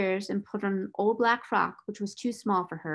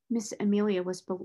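plain reading voice

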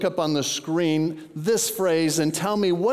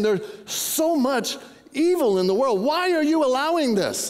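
high-pitched, acted complaint to God; pleading protest; 8 semitones higher than usual. The prophet questioning God about evil, pleading protest. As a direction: protest upward as the baffled prophet.

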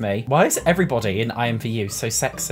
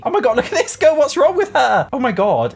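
high, loud shocked exclamation; shocked squeal; 10 semitones higher, 6 dB louder than usual. Real, not acted. Gamer shocked by a weird avatar, loud high squeal. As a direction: blurt it out in high-pitched disbelief.

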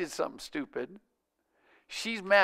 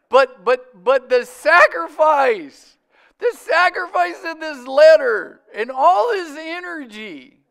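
loud, slow, high-pitched preaching cry on sacrifice; preaching shout; 8 semitones higher, 9 dB louder, slower than usual. Real, not acted. Pastor preaches sacrifice to the congregation, loud and slow. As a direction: slowly cry out the word sacrifice.

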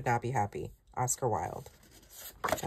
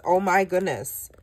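ordinary talk

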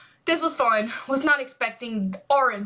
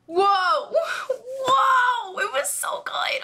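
excited shout; 11 semitones higher, much slower than usual. Real, not acted. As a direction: cry out high and thrilled. Hyped reacting to performance.

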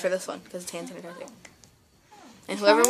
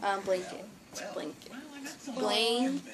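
whispering; 15 dB quieter than usual. Shown as a demonstration. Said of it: "the backwards whisper".

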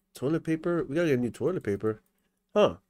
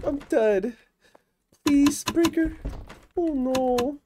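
much higher, panicked pleading, louder in bursts; real panic; 11 semitones higher, 3 dB louder than usual. Real, not acted. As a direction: voice shooting up in real panic while trapped. Trapped, thinks he is dead.

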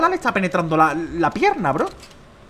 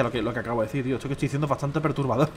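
lower, softer admission; low aside; 4 semitones lower, 7 dB quieter, faster than usual. Real, not acted. Streamer owning up that his joke is disturbing, low aside. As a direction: own up to it quietly.

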